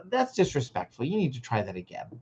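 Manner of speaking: put-on steady, gentle correction to a child; softening at the end as you ask for a redo; calm redirect. Shown as a demonstration. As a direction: stop them kindly, then ask for a redo. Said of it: "We wanna say things like".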